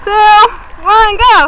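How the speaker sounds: loud countdown; excited shout. Real, not acted. Countdown before jumping off rocks.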